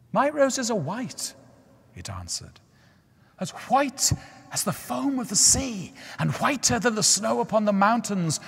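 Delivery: high, grand rose tree voice; the tree refusing gently but proudly. A narrator's reading.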